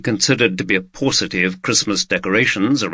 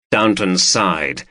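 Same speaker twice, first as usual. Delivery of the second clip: ordinary delivery, nothing marked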